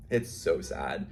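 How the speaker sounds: ordinary talk, nothing marked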